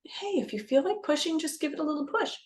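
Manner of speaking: high, lightly coaxing voice; imitated gentle encouragement. Someone suggesting a little push in labor, gentle encouragement. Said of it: "maybe someone says".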